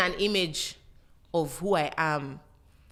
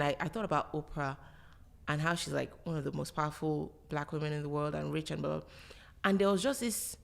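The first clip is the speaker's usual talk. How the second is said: normal talking voice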